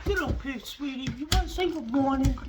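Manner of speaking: high, sing-song pet voice. Real, not acted. Owner calling his dog up onto the bed, high baby talk. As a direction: coo it up high like talking to a puppy.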